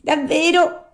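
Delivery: high, loud, whining echo; echoing cat. A narrator's reading. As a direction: echo the fox's wail as the cat. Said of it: "ripeté il gatto".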